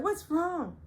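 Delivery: high, fake concerned; high nosy imitation; mock nosy women. The women crowd in.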